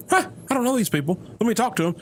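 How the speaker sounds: host mimics his outgoing friend meeting strangers, mock cheerful; imitating Bull. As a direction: lightly play the friend who just walks up.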